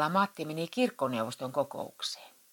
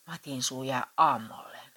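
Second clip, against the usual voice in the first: ordinary delivery, nothing marked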